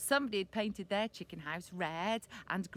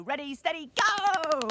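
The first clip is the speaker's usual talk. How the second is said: high, loud ready-steady-go; race starter's call; 7 semitones higher, 10 dB louder than usual. Pretend. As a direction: call it out, building to a big GO.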